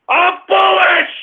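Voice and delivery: high, slow "I'm bullish"; a host hyping the stock market; excited shout. Acted. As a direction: proclaim it loud and proud. Proclaiming again.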